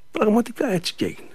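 usual speaking voice

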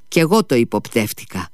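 plain reading voice

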